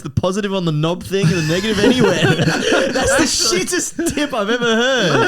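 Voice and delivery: high, laughing mockery; laughing ridicule. Real, not acted. Ridiculing a friend's battery tip, helpless laughter. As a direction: break up laughing as you mock the tip.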